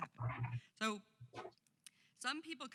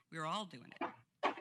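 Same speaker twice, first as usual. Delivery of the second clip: normal talking voice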